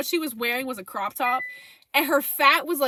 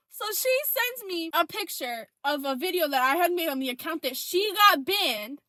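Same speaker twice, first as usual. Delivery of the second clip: ordinary talk, nothing marked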